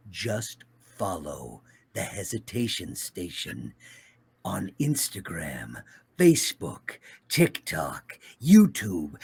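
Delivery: slow, grave anime villain; solemn villain plugging socials; Obito voice. Acted. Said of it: "to get Obito to tell people to follow".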